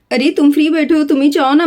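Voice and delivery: normal talking voice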